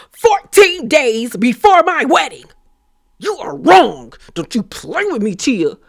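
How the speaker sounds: mock indignation, pitch and volume jumping mid-rant; hammed-up dramatic confrontation; overperformed outrage. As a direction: play her outburst way over the top. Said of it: "Like, she overperformed that".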